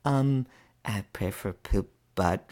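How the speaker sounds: put-on slow, sly little-girl voice; a hint of a grinning toddler; mischievous child. Daughter answering at bedtime prayers, mischievously slow. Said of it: "She's like".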